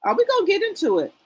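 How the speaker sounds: quick, high, eager question; teasing chat into gossip; excited tease. Real, not acted. Streamer hyping her chat for gossip, excited and quick.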